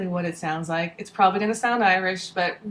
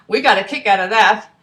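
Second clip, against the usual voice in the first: louder, brisk storyteller voice; lively story narration; 4 semitones higher, 8 dB louder than usual. A narrator's reading. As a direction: tell the adventure with gusto. Reads story in character voice.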